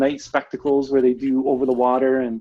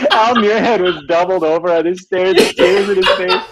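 a hint of Cody, louder and laughing; mock Cody voice; 5 semitones higher, 7 dB louder than usual. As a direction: play it back at him teasingly. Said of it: "talking back at Cody in Cody's voice".

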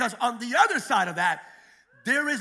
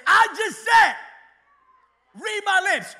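lightly voiced prophet, laughing, "no more rain" drawn out; a hint of a swaggering prophet with a laugh; mock Elijah decree; 3 semitones lower, 7 dB louder, much slower than usual. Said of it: "That's exactly how he said it".

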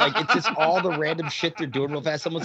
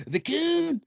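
high mock The Coon. South Park character name.

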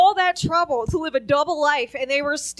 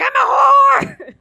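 high, very loud drawn-out shout; yelled confession; 11 semitones higher, 10 dB louder, much slower than usual. Retold or imitated. Daughter yelling in Times Square, drawn-out shouted confession. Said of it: "if you yell".

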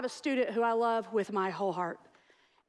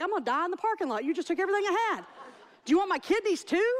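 high, fast comic protest; mock complaint; 6 semitones higher, faster than usual. Patient protests to blood lab staff, mock outrage. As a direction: overdramatize the drained patient's grievance.

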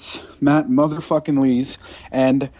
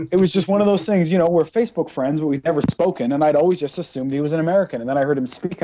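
usual speaking voice

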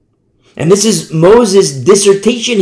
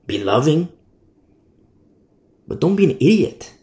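ordinary talk

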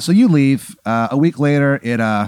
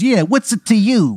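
tough mobster; 10 semitones higher, 4 dB louder than usual. Acted. Mobster challenging, high far voice.

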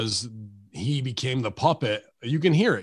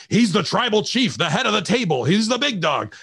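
higher-pitched, hyped-up announcer parody; mock wrestling commentary; 8 semitones higher, 3 dB louder than usual. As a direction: parrot the hype like an excited commentator. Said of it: "And then on commentary".

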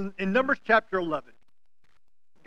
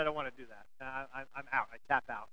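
low mock refusal; 7 semitones lower, 16 dB quieter, faster than usual. Refusing to carry luggage.

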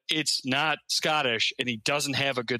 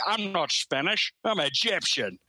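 Sean Connery; 4 semitones higher than usual. Retold or imitated. The best Scottish accent in the scene denying being Spanish, Scottish impression. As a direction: stretch it out in a mimicked Scottish accent. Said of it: "the guy doing the best Scottish accent".

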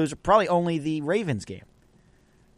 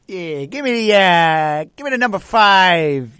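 put-on New York accent; 7 dB louder than usual. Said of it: "goes back to that deli in New York".

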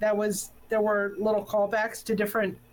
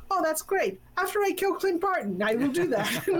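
imitating Yelena; 6 semitones higher than usual. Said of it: "She goes".

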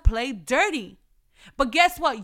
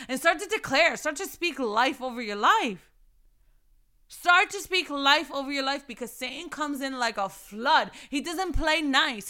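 normal talking voice

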